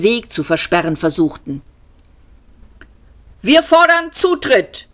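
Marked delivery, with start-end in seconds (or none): 3.4-4.9 high, loud demanding shout. A narrator's reading. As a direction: yell the demand at the door. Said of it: "schrie der jüngere der beiden". (hoher, lauter fordernder Schrei)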